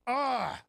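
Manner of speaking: loud, high exasperated cry; frustrated yell. Retold or imitated. Dad reacting to daughter's confession, mock frustrated yell. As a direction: burst out like a dad at wit's end. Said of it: "he's just so frustrated".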